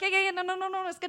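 high, loud, hurried insistence; frantic reassurance. Retold or imitated. Daughter assures doubtful teller the deal is legit, frantic. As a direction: brush off the doubt in a high-pitched panic.

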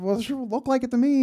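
high-pitched, protesting comeback; defensive retort. Retold or imitated. Driver defending his account to the officer, defensive. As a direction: argue back, pitched well up.

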